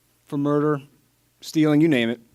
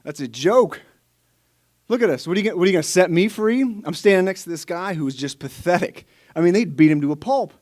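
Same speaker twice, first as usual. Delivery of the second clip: louder, higher scoffing disbelief; incredulous thought; 4 semitones higher, 5 dB louder than usual. Acted. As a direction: scoff at how absurd your chances are.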